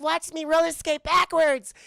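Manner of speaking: high, loud, drawn-out mock child voice; little girl showing off. Little Vivian boasts about her roller skating, mock child voice. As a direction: call out proudly as an imitated little girl.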